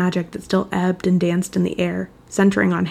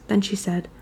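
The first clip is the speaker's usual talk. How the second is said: ordinary delivery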